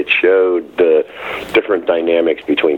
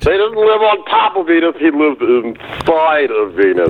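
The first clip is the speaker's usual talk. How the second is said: crank host voice; 10 semitones higher than usual. Acted. Same parody host voice.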